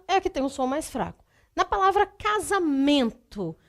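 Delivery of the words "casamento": "casamento" with MEN drawn out and punched; stress on MEN. Shown as a demonstration. Teacher shows students the strong syllable, MEN stressed in "casamento". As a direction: lean slowly on the MEN in "casamento". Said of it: "a sílaba mais forte é men".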